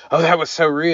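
tut-tut like a pearl-clutcher; scandalized. Retold or imitated. Everybody gasping at her rudeness, mock shock. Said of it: "Everybody was like".

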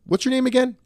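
high-pitched take on an approving boss; lightly voiced boss. Boss to new guy over coffee, lightly acted high voice. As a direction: pitch it well up, as the boss would.

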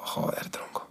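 breathless whisper, barely audible; a hushed, weary curse to himself; whispered exasperation. Retold or imitated. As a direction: drop to a whisper, fed up. Exasperated aside under breath.

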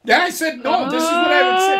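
loud, high, overlapping burst; high, loud, eager yell; excited shout. Real, not acted. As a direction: jump in shouting to correct him. Shouting back at the co-host.

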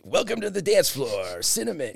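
lightly voiced sleazy club DJ; a hint of a sleazy club announcer; strip club DJ voice. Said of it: "the strip club D J voice".

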